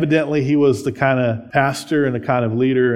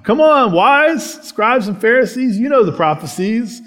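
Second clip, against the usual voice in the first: louder, higher call to the scribes; rebuking Jesus; 8 semitones higher, 3 dB louder than usual. Acted.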